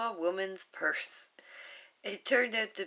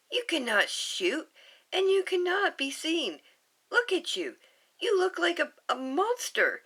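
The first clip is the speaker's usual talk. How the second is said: high, louder fussy scold; fussy alien scold; 11 semitones higher, 6 dB louder than usual. Acted. Pleakley scolding Jumba, high and louder. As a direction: scold your partner in a high fuss.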